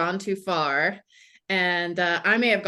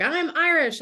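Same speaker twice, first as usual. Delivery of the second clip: bright, pitched well up, proudly announcing it; mock the eager tourist claiming "I'm Irish" high and beaming; hammed-up proud American saying "I'm Irish"; 9 semitones higher than usual. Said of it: "they're like".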